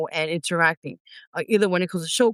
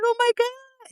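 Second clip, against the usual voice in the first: high, emotional cry of joy; voicing her proud dad; dad's joy; slower than usual. Retelling her dad seeing the trophy, a lightly voiced joyful exclamation. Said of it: "and he's still crying".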